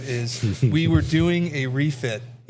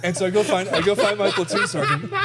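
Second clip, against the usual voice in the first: words broken by real laughter; laughing at the memory; laughing through it; 5 semitones higher than usual. Real, not acted. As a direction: tell it while cracking up. Laughing at his own story.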